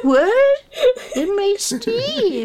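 put-on high, slow little old lady; tiny old lady voice. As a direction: squeak it out like a tiny granny. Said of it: "your old, old lady voice".

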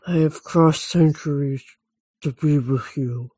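low movie-vampire voice; put-on Dracula accent. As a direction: intone it as a lovelorn count. Performs Dracula's movie line.

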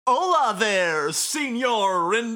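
high, loud, slow hammy greeting; flamboyant Spanish accent. Acted. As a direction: greet him like a showman. Voices Motoi greeting.

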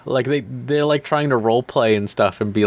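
usual speaking voice

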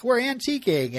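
high-pitched mock sing-out; lightly acted couple. Richard and Emily explain to Lorelai, acted high voice. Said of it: "who are like".

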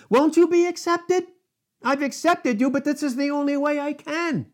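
fervent preacher voicing the Lord; preaching at full force. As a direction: plead with Cain from on high.

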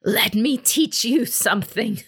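high, strained defiant voice; last-breath defiance. A narrator's reading.

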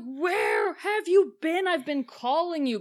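high, slow, anxious; worried demand. Retold or imitated. Kaz confronting her after Otto was shot, high and anxious. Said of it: "And he's just like".

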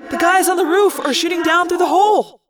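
high-pitched shouted warning; alarmed cry. A narrator's reading. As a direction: shout it from the thick of the fight. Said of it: "Tobias cried".

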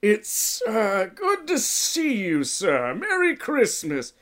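a nervous elf stammering a greeting. A narrator's reading. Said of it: "both surprised and terrified".

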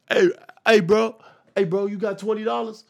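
high, loud mock beggar's holler; yelling panhandler. Bum asking a passerby for twenty dollars, mock yelling. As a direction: imitate someone yelling across the street. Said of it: "a bum yell across the street".

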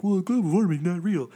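mocking voice. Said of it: "people are like".

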